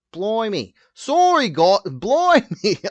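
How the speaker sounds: put-on high, loud, botched British accent; fake British accent. Host fumbling for an accent on the spot, bad British accent. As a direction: overdo a bad British accent, stretching the vowels. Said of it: "think of a fucking British accent".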